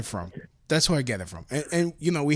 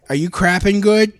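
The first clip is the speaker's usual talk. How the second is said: raised, louder teasing question; retold jokey check-in, pitched up and loud; playful call to grandma; 5 semitones higher, 8 dB louder than usual. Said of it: "what I do with my grandmother, I call her".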